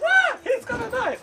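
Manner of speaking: high, loud mock frightened cry; scared girlfriend. Retelling the film date, presenter imitates the girlfriend, high and panicky. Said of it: "And she goes".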